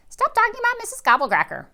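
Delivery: squeaky high, fast mimicry; mock her in a silly squeal; mocking copy. A narrator's reading. Rascal copies Violet, high fast mocking squeal. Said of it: "I copy her".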